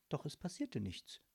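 ordinary delivery

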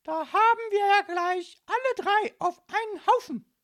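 very high, loud gotcha shout; shrill policewoman bursting in; calling out. A narrator's reading. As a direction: crow it across the room. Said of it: "rief die Polizistin in den Raum".

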